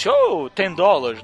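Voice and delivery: slow, put-on animatronic goblin voice; goblin clerk voice. Mocking the animatronic goblin at the exchange counter, slow put-on voice. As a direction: drag out the English like a stiff robot goblin. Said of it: "com aquela voz assim".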